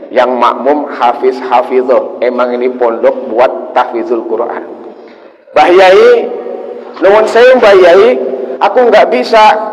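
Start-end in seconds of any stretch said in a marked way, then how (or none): 5.5-9.7 high, loud panicked plea; pleading boy. Acted. Boy begs the kiai to excuse him, high and loud. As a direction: beg out of leading the prayer.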